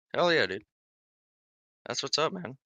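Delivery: normal talking voice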